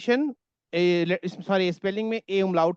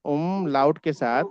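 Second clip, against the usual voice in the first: ordinary talk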